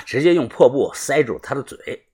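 ordinary delivery, nothing marked

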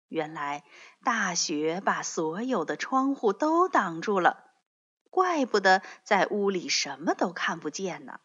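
usual speaking voice

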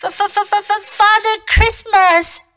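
high-pitched stammering fox; terrified little stammer; frightened stammer. A narrator's reading. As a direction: stutter hard on the first sound, then get it out high. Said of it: "stammered the fox".

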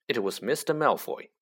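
ordinary delivery, nothing marked

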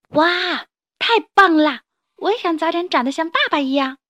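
amazed at dad's tail swing; excited child. Acted. 小恐龙赞叹. (小孩子激动地惊叹)

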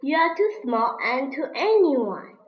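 ordinary delivery, nothing marked

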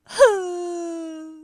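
loud comic boo-hoo wail; crying parents' wail; hammed-up sobbing. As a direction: sob it out theatrically. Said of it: "伤心地喊道".

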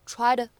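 "tried" said as "try to" with a flapped t; "tried" as a quick flapped "try-da". Shown as a demonstration. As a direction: link "tried" with an American flap. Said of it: "咱们会读成tried".

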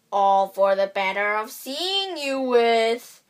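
slow wobbly fake grandma voice; wolf quavering like an old grandma. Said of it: "in the same wobbly voice".

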